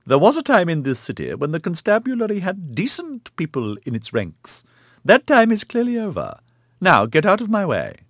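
put-on high, loud indignant old lady; stern old matriarch. A narrator's reading. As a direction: berate him in a wavering old voice. Old woman's voice continues.

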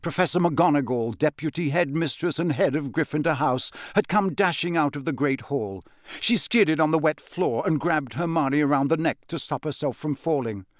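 ordinary delivery

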